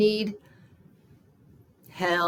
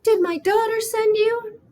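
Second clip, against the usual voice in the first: much higher, louder, suspicious question; young-sounding woman; 13 semitones higher, 5 dB louder than usual. Acted. Homeless Becky questioning a stranger, high young voice. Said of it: "her voice sounded young".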